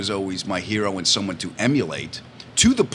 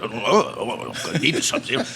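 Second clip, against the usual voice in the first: Rickles impression. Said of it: "And Rickles like".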